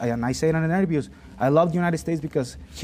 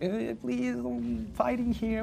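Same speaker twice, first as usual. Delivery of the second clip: mock security guard; 9 semitones higher, 7 dB quieter, much slower than usual. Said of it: "the security guy was like".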